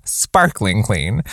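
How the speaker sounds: sparkling clean said slowly, each word leaned on; slow emphatic repeat. Real, not acted. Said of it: "sparkling clean repeated".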